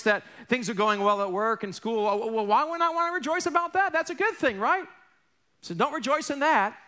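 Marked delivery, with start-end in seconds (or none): 2.1-4.9 higher, incredulous objector; puzzled listener. Retold or imitated. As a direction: push back like a confused churchgoer.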